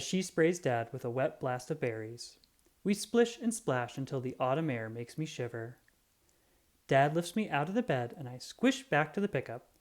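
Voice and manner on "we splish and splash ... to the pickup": plain reading voice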